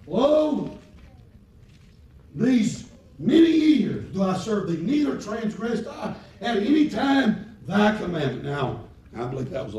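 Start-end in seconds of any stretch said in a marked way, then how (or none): 0.1-8.4 big high shouted Lo, slow and loud; preached quote, loud. Shown as a demonstration. Preacher shouting the elder son's words to the congregation. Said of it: "He said".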